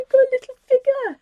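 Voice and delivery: high, loud and fast, giddy; gleeful squeal. Real, not acted. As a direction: squeal it with delight. Laughing about her figure.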